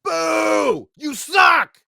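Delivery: high, full-voiced heckle; shouted booing. Heckler at Deal or No Deal booing the banker, shouting. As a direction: boo and jeer at the top of your voice. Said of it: "we gotta boo the banker".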